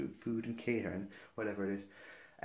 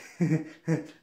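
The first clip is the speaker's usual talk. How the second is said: higher, louder put-on chuckle; imitated laugh; 4 semitones higher, 5 dB louder than usual. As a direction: mimic a server laughing at a supposed joke. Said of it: "laughing, thinking my grandma was joking".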